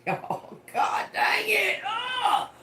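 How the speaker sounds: real laughing squeal at an embarrassing memory; laughing shriek. Real, not acted. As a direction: squeal of laughter jumping way up high. Laughing outburst about her past.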